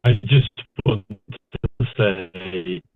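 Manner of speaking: slow broken-up call audio; bad connection. Real, not acted. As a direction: voice breaking up on a bad line. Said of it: "you're breaking up a little bit".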